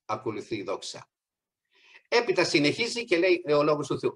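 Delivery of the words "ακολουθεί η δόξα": repeated more softly, dropping away; softer repeat. Shown as a demonstration. Preacher repeating the point to the congregation, softer. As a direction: let the repeat sink softer. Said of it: "Πρώτα πάμε με πίστη και μετά".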